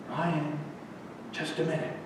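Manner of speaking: very soft, hushed plea to wait; gently calling back. Acted. Old woman stopping Ryan as he leaves, hushed and gentle. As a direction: call him back almost under the breath.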